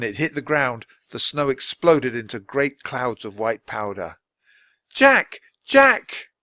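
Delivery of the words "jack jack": very high, loud, distant call; far-off desperate calling. A narrator's reading. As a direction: cry out his name across the wind. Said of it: "Annie's voice in the screaming wind".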